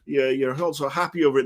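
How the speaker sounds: mocking. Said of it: "I was like".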